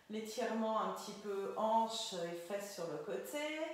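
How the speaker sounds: usual speaking voice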